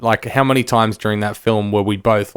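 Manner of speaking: normal talking voice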